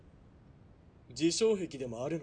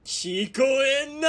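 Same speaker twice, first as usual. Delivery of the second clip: slow, raised and louder, drawn out; mocking taunt; 8 semitones higher, 6 dB louder, slower than usual. Villain mocking the girl's plea, slow loud taunt. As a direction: make her beg again. Villain mocks her begging.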